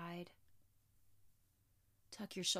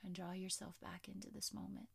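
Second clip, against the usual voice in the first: soft spa whisper; 5 dB quieter than usual. Acted. Yoga teacher whispering cue.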